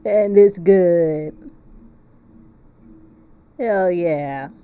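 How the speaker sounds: loud, very slow and drawn out; loud slow hype. Real, not acted. Streamer hyping the game to chat, loud and slow. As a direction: stretch every word loudly like a hype man.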